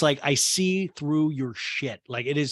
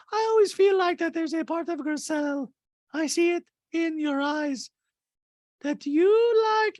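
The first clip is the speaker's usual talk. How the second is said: Diana impression; 3 dB louder than usual. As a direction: coo it up high and drag it out. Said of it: "Diana goes".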